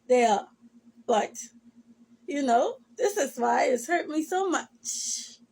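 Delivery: ordinary talk, nothing marked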